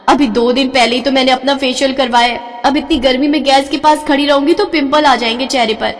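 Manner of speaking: ordinary talk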